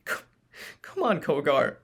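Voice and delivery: shaky, begging voice; trembling plea. Acted. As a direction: beg through tears. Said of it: "begged with a trembling voice".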